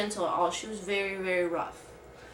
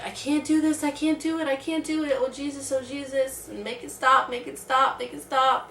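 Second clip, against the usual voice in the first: higher and a bit louder, a run of frantic pleas; a laboring wife begging her husband, pitched up and pleading; pleading loudly; 5 semitones higher, 4 dB louder than usual. Retold or imitated. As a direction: beg your husband to make the pain stop. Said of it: "I was definitely talking very loud".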